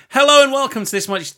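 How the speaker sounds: big booming hello; overly loud intro hello; too loud. Real, not acted. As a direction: blast the greeting out loud and high. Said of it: "Oh, that was too loud".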